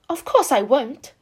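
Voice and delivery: loud, slow indignant snap; flashing retort. A narrator's reading. Anne snapping back at Jane, loud and slow. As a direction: fire it back with spirit. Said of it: "flashed Anne".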